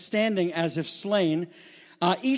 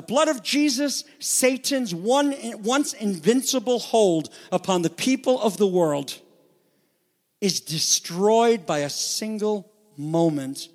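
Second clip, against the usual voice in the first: high, driving pulpit delivery; impassioned preaching; 4 semitones higher, 3 dB louder than usual. Real, not acted. Preacher pressing the slain Lamb on congregation, impassioned preaching. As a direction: preach it with rising fervor, pitch way up.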